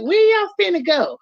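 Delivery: put-on high, lightly drawled Southern accent; mock Southern accent. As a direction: tease the Southern twang. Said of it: "we get that accent".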